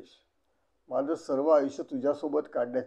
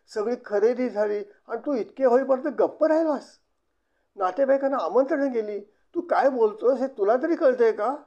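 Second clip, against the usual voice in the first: higher and louder, agitated disbelief; distraught outburst; 8 semitones higher, 6 dB louder than usual. A narrator's reading. Yamini, distraught, confronts Mahesh about the cancelled wedding. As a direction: pour out upset protest. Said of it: "Yamini उद्विग्न झाली".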